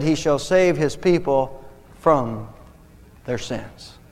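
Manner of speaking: slow, "from" set apart by pauses; stress on "from". Shown as a demonstration. Gives correct preposition after two wrong ones.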